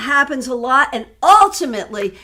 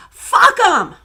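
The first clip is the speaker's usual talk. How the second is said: high, loud mock proclamation; quoting desk woman; 10 semitones higher, 6 dB louder than usual. Repeating the desk woman's wise words as advice, loud and high imitation. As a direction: belt it out high as an imitated quote. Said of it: "remember the wise words of the woman".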